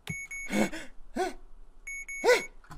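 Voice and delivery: sharp, voiceless gasps; startled gasp. Real, not acted. Player jolted by the horror game, startled gasping. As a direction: gasp in sudden fright.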